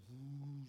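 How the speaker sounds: drawn-out "whoo" for sudden speed; imitated whoosh. Preacher mimics the boat taking off, imitated whoosh. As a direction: make a swooping whoosh with the voice.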